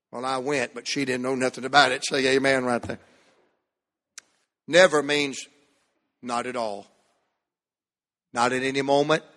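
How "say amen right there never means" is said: normal talking voice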